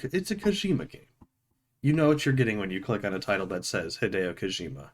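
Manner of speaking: ordinary talk, nothing marked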